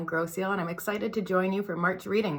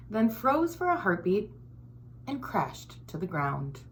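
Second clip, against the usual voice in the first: put-on lively storybook read-aloud for kids; reading to children; 4 dB quieter than usual. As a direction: read it like story time for little ones. Reads picture book to children.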